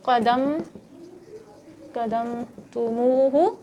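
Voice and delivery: drawn-out, syllable by syllable; slow recitation. Real, not acted. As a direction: stretch the word slowly. Word recited slowly. (قدمتموہ آہستہ کھینچ کر)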